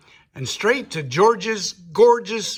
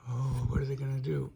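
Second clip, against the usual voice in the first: soft, quick gasp then a murmured question; hushed gasp aside; 4 semitones lower, 9 dB quieter, faster than usual. Real, not acted. Reader asking the child what happens next, soft and fast. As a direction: lean in and wonder softly with the listener.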